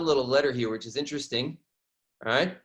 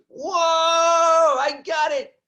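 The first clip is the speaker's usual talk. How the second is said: high, slow, loud cry of discovery; thrilled yell of discovery; excited shout; 7 dB louder, much slower than usual. Retold or imitated. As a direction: shout it like you just found treasure.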